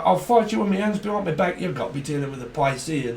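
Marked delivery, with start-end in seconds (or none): 0.0-1.6 mock boast. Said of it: "It's like".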